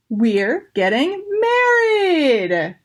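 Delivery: slow, drawn-out happy announcement; excited announcement. Acted. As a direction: savour the big news. Uncle Jamie announces the wedding.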